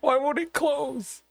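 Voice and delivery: a wailing plea through giggles; mock wail. Real, not acted. Friend laughing, wailing at the stuck trunk. Mock distress about the box.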